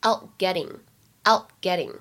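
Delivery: "out getting" with an unreleased t in out and flapped t in getting; lost plosion, flapped t. Shown as a demonstration. Teacher demonstrates American linking, "out getting" with held and flapped t. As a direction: hold back the t of "out", flap the tt in "getting". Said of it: "也有美音浊化的现象".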